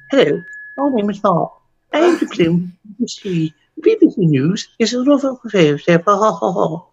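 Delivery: mock BBC-newsreader English accent. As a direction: put on a caricature of typical English speech. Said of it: "people in England talk like that".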